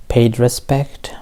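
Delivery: usual speaking voice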